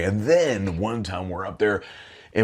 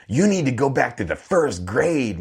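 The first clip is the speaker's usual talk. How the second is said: higher-pitched, imitated scolding; mocking put-down; 6 semitones higher than usual. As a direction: jeer it higher, as an acted insult.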